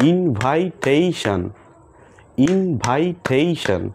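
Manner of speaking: ইনভাইটেশন slow in four beats, in-vai-tei-shon; slow clapped syllables. Shown as a demonstration. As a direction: clap four times, slowly: ইনভাইটেশন. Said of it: "আমরা তালির মাধ্যমে সেই ওয়ার্ডগুলোকে শিখব".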